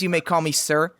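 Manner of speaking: usual speaking voice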